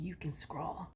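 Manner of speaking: quick whispered aside; whispering. Real, not acted. Aside to non-husband viewers.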